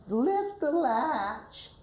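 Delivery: slow, high, feeble grandma; high-pitched grandma voice. Shown as a demonstration. Coach modeling the weak grandma line. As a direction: draw it out high and weak, like she can't get up. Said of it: "a high-pitched voice".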